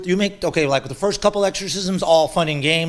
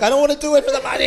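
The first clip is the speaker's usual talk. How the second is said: mock whine; 10 semitones higher, 3 dB louder, much faster than usual. Self-quote, high and fast.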